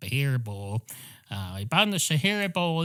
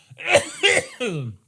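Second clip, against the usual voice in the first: real cough; 12 semitones higher, 6 dB louder than usual. Real, not acted. Cough before bless you.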